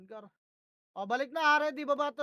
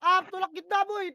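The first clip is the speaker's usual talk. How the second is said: raised, higher, louder commands; urgent push calls; 6 semitones higher, 10 dB louder than usual. Real, not acted. Leading teammates into a push mid-match, urgent loud calls. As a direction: drive the team forward with each call.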